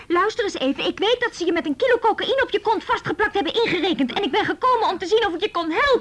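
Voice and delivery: loud, high, furious retort at her mother; angry outburst. Retold or imitated. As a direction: shout her down in anger. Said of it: "Ik werd kwaad en zei". (woedend uitvallen tegen moeder)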